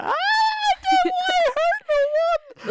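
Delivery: very high, slow wailing plea; high slow sobbing plea; crying plea. Acted. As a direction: wail it while clinging to a knee.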